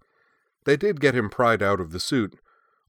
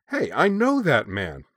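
high, drawn-out excited exclamation; slow, high-pitched delighted discovery; surprised recognition; 6 semitones higher, 3 dB louder than usual. A narrator's reading. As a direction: exclaim as you suddenly recognize someone. Sudden recognition on looking closer.

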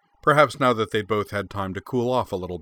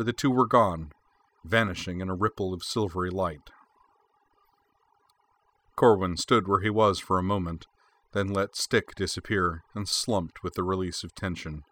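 ordinary delivery

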